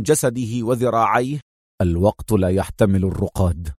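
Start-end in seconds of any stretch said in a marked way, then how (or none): 1.8-3.7 low, firm man's voice; stern reply. A narrator's reading. Man pulls out his tubes, low and stern. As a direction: refuse curtly. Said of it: "أجاب في صرامة".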